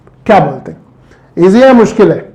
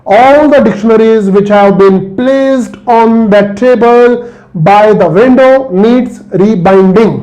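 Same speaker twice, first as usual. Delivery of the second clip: slow, careful reading; much slower than usual. Shown as a demonstration. Teacher reads the exam sentence aloud, very slowly. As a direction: read it out very slowly. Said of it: "आराम से पढ़िए".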